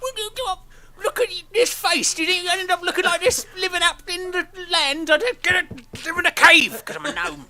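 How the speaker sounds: very high, loud gnome, giggling; laughing gnome. Acted. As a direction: show off the gnome face, cracking up.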